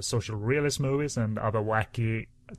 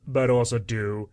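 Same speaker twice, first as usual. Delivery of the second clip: ordinary talk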